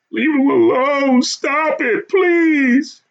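very high, pleading screams; crying and screaming. A narrator's reading. Wife begs madman to leave her husband alone, crying and screaming. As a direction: scream it out as a desperate plea. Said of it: "wife was crying and screaming".

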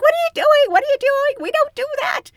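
high, loud alarmed cry; alarmed shout. Retold or imitated. Senior attendant stops new colleagues gagging a passenger, alarmed shout. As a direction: stop them in a panic.

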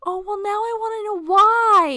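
high, loud outburst of wanting to know; eager curiosity. Real, not acted. Co-player reacts to the plan to kill Odin, loudly curious. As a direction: burst out high and loud, dying to hear the reason.

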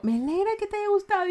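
high, quick sweet imitation; instructor's reply, imitated. (imitación aguda y rápida)

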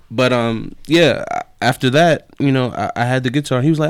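ordinary delivery

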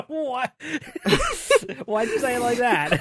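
high laughing disbelief; cracking up mid-question. Real, not acted. Laughing reaction.

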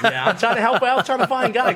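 high, laughing through words; explaining the setup, laughing. Real, not acted. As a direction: talk while cracking up.

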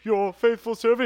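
high, loud henchman voice; pleading underling. Retold or imitated. Minion reminding Lord Frieza who Pui was, pleading. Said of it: "abridged series when he's just like".

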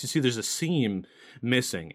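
normal talking voice

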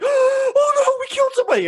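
very high, hammed-up horrified squeal; mocking the fighters' sudden shock, squeaky and overdone; mock shocked gasp. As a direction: parody the fighters' fake dismay.

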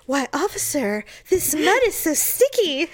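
high put-on coy voice; hammed-up flirty line to an officer; mock porn damsel. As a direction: play the bad-porn ingenue. Joke porn scene with officer.

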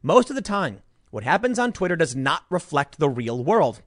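ordinary talk

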